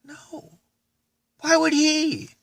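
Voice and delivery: high, stunned disbelief at the news; shocked disbelief. Retold or imitated. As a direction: gasp it out, can't believe it. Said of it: "and I'm like".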